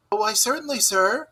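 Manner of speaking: high, polite little voice; respectful field mouse. A narrator's reading. Field mouse answering Rat respectfully, high and polite. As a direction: answer the gentleman deferentially. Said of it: "replied the field mouse respectfully".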